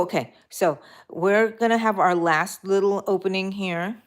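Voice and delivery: ordinary delivery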